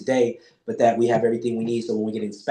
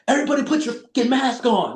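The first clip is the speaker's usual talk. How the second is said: high-pitched mock yell; hammed-up enforcer's command, pitch way up; imitated bossy order; 11 semitones higher than usual. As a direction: call out a rule to the whole room. Acting out himself enforcing the rules.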